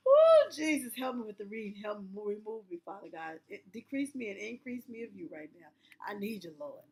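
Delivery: quiet, low plea to the Lord; quiet, pleading prayer; muttered prayer. Real, not acted. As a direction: pray softly under your breath for strength. Praying mid-reading.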